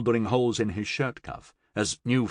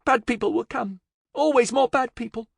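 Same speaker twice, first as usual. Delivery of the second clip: put-on high, clear little child voice; small girl's clear voice; 14 semitones higher than usual. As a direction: speak plainly, like a small child warning. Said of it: "she said in a clear voice".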